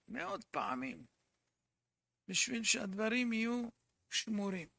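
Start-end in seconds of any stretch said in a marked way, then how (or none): 0.1-1.1 מאות פעמים repeated much lower and softer, like an aside; quiet echo. Shown as a demonstration. Preacher echoing his own words to the congregation, low and soft. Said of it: "חוזרים מאות פעמים".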